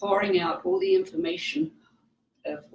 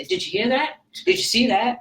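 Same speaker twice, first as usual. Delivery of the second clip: a touch of ghost-hunter excitement, louder; hammed-up TV ghost hunter asking; mock ghost-show hype; 6 semitones higher, 6 dB louder than usual. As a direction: lightly parody a TV ghost hunter. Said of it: "the ghost hunting shows where they say".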